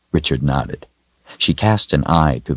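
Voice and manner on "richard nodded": ordinary delivery, nothing marked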